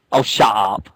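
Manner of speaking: ordinary talk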